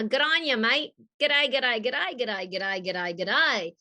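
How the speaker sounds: broad Aussie greeting, rattled off playfully; thick Australian accent. Shown as a demonstration.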